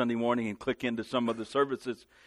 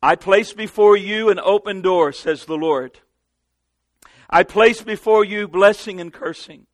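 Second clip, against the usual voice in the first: much louder, much higher proclamation; prophetic preaching; 7 semitones higher, 10 dB louder than usual. Acted. Preacher declaring prophecy to the church, much louder and higher. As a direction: thunder it as a word from God.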